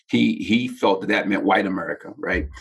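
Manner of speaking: usual speaking voice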